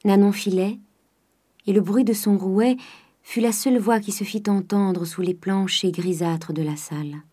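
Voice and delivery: ordinary delivery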